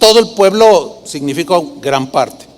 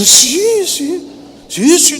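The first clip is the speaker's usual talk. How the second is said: high, mock-eager yeses; gullible agreement; 8 semitones higher, slower than usual. A flattered person believing the flatterer, gullible. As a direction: swallow the flattery in a put-on high voice.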